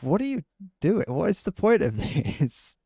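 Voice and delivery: high, incredulous mocking of posters; mock exasperation. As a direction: sneer at the pointless post with a laugh. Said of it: "And it's like".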